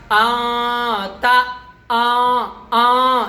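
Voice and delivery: آ held and hummed through the nose; nasalized sound. Shown as a demonstration. Tajweed teacher showing ikhfa to students, nasal آ. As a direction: let the آ resonate nasally, drawn out. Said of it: "یہ جو آواز جو جا رہی ہے ناک میں".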